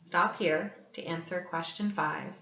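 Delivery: ordinary delivery, nothing marked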